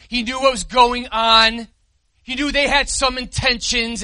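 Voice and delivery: loud, high proclamation; fervent pulpit declaration; preaching at full force. Acted. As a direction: lift it up for the whole church. Preacher proclaims Jesus's kingship.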